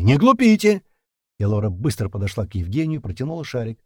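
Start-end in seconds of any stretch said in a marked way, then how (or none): 0.0-0.8 high, loud warning shout; shouted demand. A narrator's reading. Pursuers shout through the blocked door, loud warning. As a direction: yell it as an order. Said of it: "закричали с той стороны".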